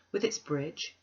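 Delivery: plain reading voice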